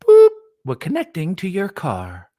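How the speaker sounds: loud chirpy AI bot; robot voice. Pretend. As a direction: announce it like a car assistant. Bot connecting to car.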